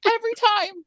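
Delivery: very high mock-weepy squeak; imitating herself bawling, helplessly overcome; crying. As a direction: wail it in a tiny high crying voice. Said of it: "this is why I'm crying".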